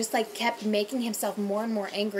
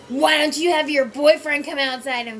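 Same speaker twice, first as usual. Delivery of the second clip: put-on drunk challenge; 5 semitones higher, 8 dB louder, slower than usual. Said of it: "he was like".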